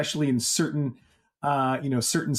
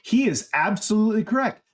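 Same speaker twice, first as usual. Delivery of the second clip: higher, mock-agreeing sarcastic tone; heavy sarcasm; 8 semitones higher than usual. Real, not acted. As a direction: drip sarcasm on "absolutely correct". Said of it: "with a he-, heavy dose of sarcasm".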